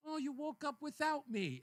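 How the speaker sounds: lightly put-on sweet complaint; good-mood wife. Wife chides husband for rising alone, cheerful. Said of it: "she's in a good mood".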